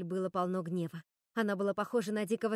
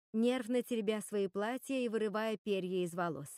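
ordinary delivery, nothing marked

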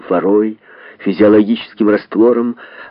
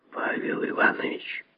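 venomous whisper of a colleague's name; angry whisper; 13 dB quieter than usual. A narrator's reading. Lazovsky to fellow surgeon over the patient, angry whisper. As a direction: whisper it with contained spite. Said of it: "сказал шёпотом и злобно Лазовский".

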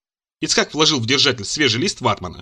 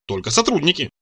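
usual speaking voice

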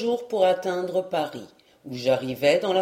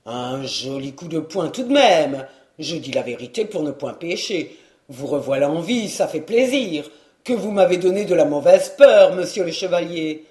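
lower, loud rustic servant beaming with relief; overjoyed servant; 3 semitones lower, 5 dB louder than usual. A narrator's reading. Servant rejoicing that his master is alive. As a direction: laugh it off, delighted he lives. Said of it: "tout radieux d'allégresse".

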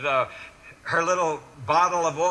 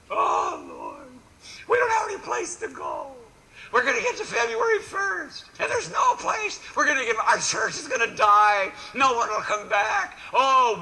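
hammed-up wail, much higher; mock weeping lament; 4 semitones higher than usual. Pastor mocks congregation's despair with a hammed-up, much higher wail. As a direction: whine to heaven like a despairing churchgoer. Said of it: "What we're gonna do is we're gonna weep".

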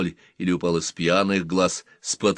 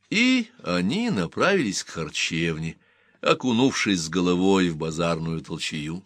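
ordinary talk

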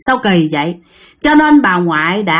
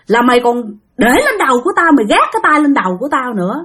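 acted scolding in a raised pitch; possessed cursing; 5 semitones higher than usual. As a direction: tell off the culprit as an offended spirit. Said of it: "bắt đầu nó chửi xong".

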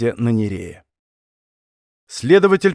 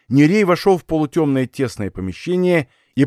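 ordinary delivery

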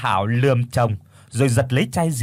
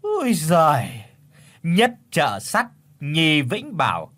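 high, slow, drawn-out scoff; scoffing tut; 4 semitones higher, slower than usual. Acted. Mother scoffs at where the suitor lives, slow and mocking. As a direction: tut and mock the neighbourhood slowly. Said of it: "Bà Hảo chép miệng".

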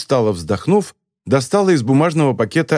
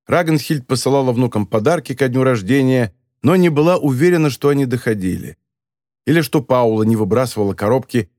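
plain reading voice

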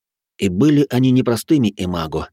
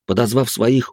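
usual speaking voice

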